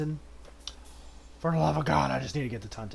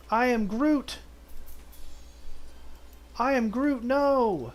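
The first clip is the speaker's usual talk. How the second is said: a hint of Groot, higher pitched; 8 semitones higher, slower than usual. Player voicing Groot to the Spriggan, pleading.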